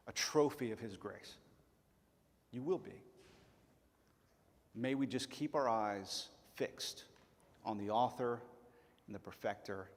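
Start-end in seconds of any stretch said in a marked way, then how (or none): none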